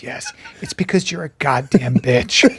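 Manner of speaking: low retold insult; mimicked deadpan. Comic retelling Jimmy's insult, mimicked deadpan. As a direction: deliver the insult low, imitating him. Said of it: "he goes like this".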